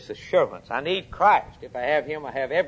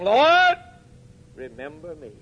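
feeble dying thief; 5 dB louder than usual. Acted. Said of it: "in a feeble voice saying".

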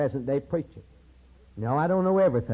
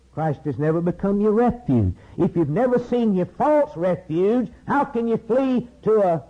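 impassioned preaching; 6 semitones higher than usual. Real, not acted. Preacher warns congregation about false refuge, building impassioned preaching. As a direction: build higher into a preacher's cry.

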